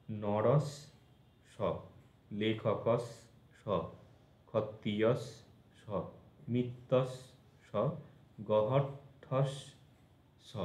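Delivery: slow, pausing before the separate final স; word ending broken off as its own স; doubled consonant split. Shown as a demonstration. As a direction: close each word on স, pause, then sound স alone. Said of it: "আবার একটা স উচ্চারণ হবে".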